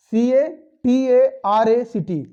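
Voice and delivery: usual speaking voice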